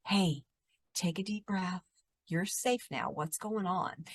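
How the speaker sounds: soft, gentle reassurance; calming. Retold or imitated. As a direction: talk an upset teenager down quietly. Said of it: "I was just trying to calm him down".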